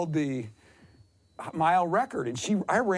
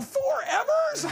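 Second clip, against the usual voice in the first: very high, slow incredulous echo tipping into laughter; mock disbelief; slower than usual. Runner answering the record holder, high-pitched incredulity. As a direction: squeal it back, stretched out in disbelief. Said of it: "I go".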